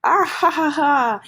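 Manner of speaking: high gloating cartoon cackle; villain laugh. Villain gloats after trick.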